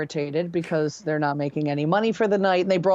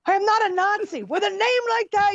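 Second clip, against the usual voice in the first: high indignant protest; 13 semitones higher than usual. Retold or imitated. Protesting to the crowd.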